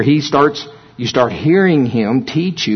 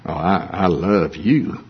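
a slow, low, tender "Oh, I, I love you"; lingering and heartfelt, each word drawn out; 5 semitones lower, slower than usual. Shown as a demonstration. Preacher showing how inflection changes meaning, tender. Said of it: "depending on how you inflect the words".

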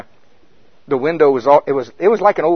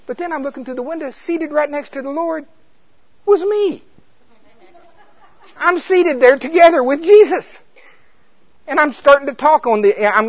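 higher and louder, slowing down with wonder; awed revelation; 12 semitones higher, 6 dB louder than usual. Retold or imitated. Preacher telling the congregation his vision, rising in awe. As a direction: let the awe rise as you see yourself.

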